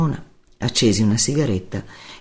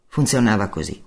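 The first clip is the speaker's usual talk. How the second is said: plain reading voice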